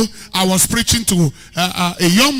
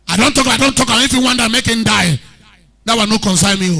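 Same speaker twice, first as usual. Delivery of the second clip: louder, blunt Pidgin English refusal; rough Pidgin; 4 dB louder, faster than usual. Retold or imitated. Preacher showing Pidgin's arrogance, loud and rough. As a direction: throw it out hard in Pidgin. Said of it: "But this one rough".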